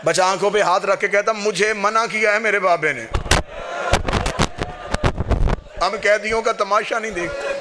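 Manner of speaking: wailing lament build. Acted. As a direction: drive the mourners toward tears, pitch rising. Lament climbing to wailing peak.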